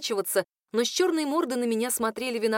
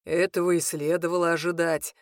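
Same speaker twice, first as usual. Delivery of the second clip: dry smirk; 5 semitones lower than usual. A narrator's reading. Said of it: "хмыкнул Хенрик".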